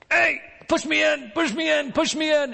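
high pleading cry, repeated; begging cripple. Retold or imitated. Paralyzed man at the pool begging passersby, high pleading call.

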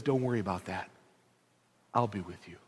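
gently, almost hushed; God comforting Moses; tender reassurance. Acted.

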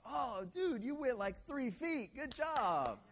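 high, loud mock praise for a child; hammed-up proud parent cheering a tiny success. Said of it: "We're like".

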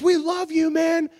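high, loud shouted declaration; mock shout. Praying guy shouting love back at the singer, loud. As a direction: shout it across the crowd with gusto.

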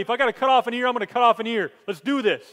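loud, high and fired up; rally yourself to swing the sword; ready to fight. Retold or imitated. Peter ready to defend Jesus, fired up. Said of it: "he's got his sword ready".

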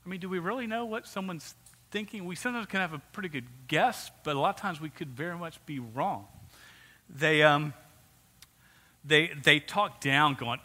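ordinary talk, nothing marked

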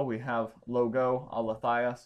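"logo" said slowly and carefully on its own; slow, deliberate. Shown as a demonstration. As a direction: spell it out slowly without the article. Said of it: "We don't have ho logo".

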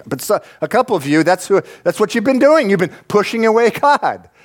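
rising to a high-pitched charge; impassioned preaching. Real, not acted. Preacher confronts those pushing God away, impassioned. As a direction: preach it with mounting intensity.

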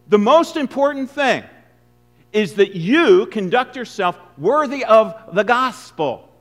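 loud, slow pulpit proclamation; preaching emphasis. Acted. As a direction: drive each word home. Preacher driving home the point.

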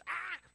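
short, loud high yelp; mock startled scream. Someone who hates surprises, mock startled scream. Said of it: "I hate surprises".

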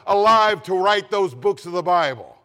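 loud soaring preacher's call; preaching at full force. Acted. Preacher rousing the congregation, loud full-force preaching. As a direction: rouse the congregation, booming.

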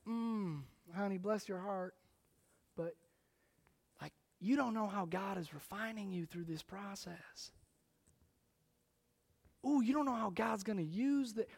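soft Southern church-friend consolation; Southern accent. Retold or imitated. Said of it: "I'm gonna use a Southern accent here".

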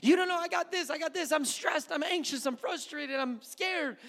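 high, whiny voice of a stressed churchgoer; mocking a worried believer. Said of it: "I'm making, making fun of you".

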